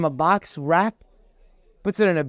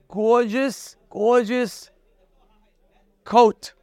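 slow, lingering gorgeous; slowly savored gorgeous; drawn-out admiring; 4 semitones higher, much slower than usual. Retold or imitated. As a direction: stretch out gorgeous like it's a treasure. Describing the coat admiringly.

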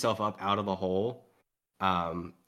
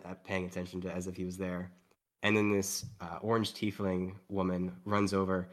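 normal talking voice

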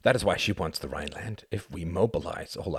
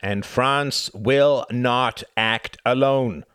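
higher, louder and very slow; emphatic; 8 semitones higher, 8 dB louder, much slower than usual. Acted. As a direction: stress every word firmly. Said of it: "said Laval emphatically".

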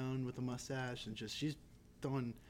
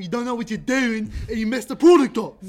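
higher, louder Baltimore yell; mock angry yell; 7 semitones higher, 15 dB louder than usual. As Idris Elba losing his temper, a mock Baltimore yell. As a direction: yell it like he's losing the accent. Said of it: "when he gets mad and yells".

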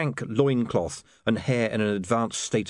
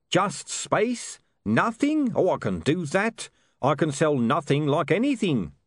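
high-pitched, delighted huckster; excited salesman, much higher pitch; Dibbler, delighted; 8 semitones higher, slower than usual. Acted. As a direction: brighten at the easy job, pitch jumping up. Dibbler brightens at selling nothing.

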